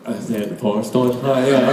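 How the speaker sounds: low-pitched, garbled mock sob; hammed-up mumbled admission; guilty confession. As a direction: imitate a guilty boy struggling to confess. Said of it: "Made me feel so guilty".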